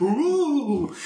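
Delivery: high, drawn-out ghostly ooh; mock spooky ooh. Playing along with the vampire bit, mock spooky ooh. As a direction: wail a playful spooky ooh.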